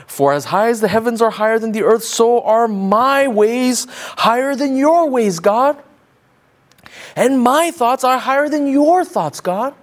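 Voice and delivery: high, loud, emphatic boast at God; hammed-up superiority, stretching the contrasts; flipped-around defiance toward God. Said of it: "we're saying this to God".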